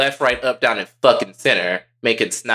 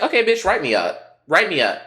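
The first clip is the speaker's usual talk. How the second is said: defiant dare; 7 semitones higher than usual. Acted. Acts defiant student daring teacher.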